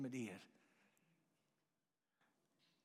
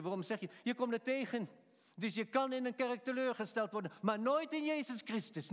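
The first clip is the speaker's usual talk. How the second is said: ordinary delivery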